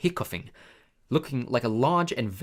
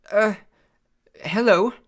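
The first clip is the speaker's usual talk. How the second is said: nervous; 9 semitones higher, 8 dB louder than usual. A narrator's reading. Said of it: "said Harry nervously".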